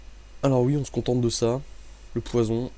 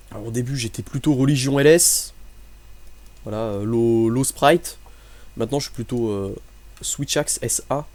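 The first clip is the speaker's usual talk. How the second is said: normal talking voice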